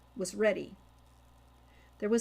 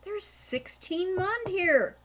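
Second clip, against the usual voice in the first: slow, high, louder counting delight; excited cry; 9 semitones higher, 4 dB louder, slower than usual. A narrator's reading. Hawk counting the coins, delighted cry. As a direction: savour the find. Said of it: "he cried".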